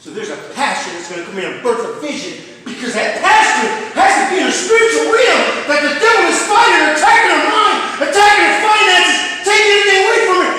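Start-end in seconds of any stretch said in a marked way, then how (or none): 3.2-10.5 high, loud preaching cry that climbs; rising sermon shout; full-force preaching. Acted. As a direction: preach it out at the top of your voice.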